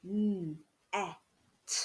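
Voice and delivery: n, e, t, each sound alone like a robot. Shown as a demonstration. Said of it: "pretend to be robots".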